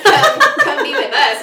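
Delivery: coaxing through laughter; laughing invitation. Retold or imitated. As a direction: beckon them in, giggling.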